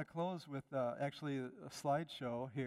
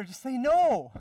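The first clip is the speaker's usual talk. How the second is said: loud, high, hammering the word no; emphatic shout; 9 semitones higher, 16 dB louder, slower than usual. Acted. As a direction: slam the no down hard. Preacher urges saying a plain no.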